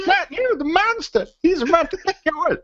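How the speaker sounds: very high-pitched cartoon mum impression; hammed-up accented falsetto; South Park chef's mum. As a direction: scold in a squeaky, put-on mother voice. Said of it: "the chef's mum's going".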